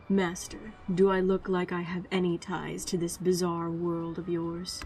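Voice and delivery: quiet, low-key stoic delivery; stoic Archer. Acted. Archer questioning Master about this bizarre world, stoic. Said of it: "Make Archer sound like Blade? Stoic?".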